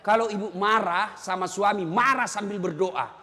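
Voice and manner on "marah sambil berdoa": usual speaking voice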